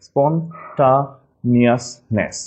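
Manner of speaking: very slow "স্পন্টানিয়াসনেস" with a spelling-style "ta"; broken into syllables. Shown as a demonstration. Teacher helping class remember, "স্পন্টানিয়াসনেস" slowly split. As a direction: split "স্পন্টানিয়াসনেস" as spon, ta, nias, ness. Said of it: "একটু ভেঙে নিলে".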